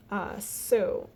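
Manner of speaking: ordinary talk, nothing marked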